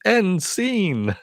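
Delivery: high, hammy stage call; theatrical announcement. Retold or imitated. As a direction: announce it like a showman. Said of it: "he comes up and goes".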